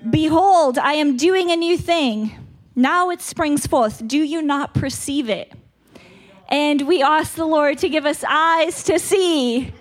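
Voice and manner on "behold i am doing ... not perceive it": proclaiming scripture. Retold or imitated. Quoting scripture with emphasis.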